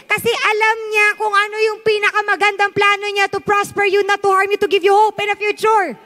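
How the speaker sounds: rally the congregation with each line; fervent sermon push; rising preacher voice. Acted. Preaches on David's partnership.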